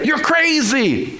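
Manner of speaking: high, drawn-out disbelief; Peter's incredulous protest. Acted. Disciple telling his master it's crazy, incredulous. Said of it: "Just like Peter".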